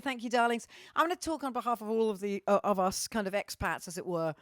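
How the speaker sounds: normal talking voice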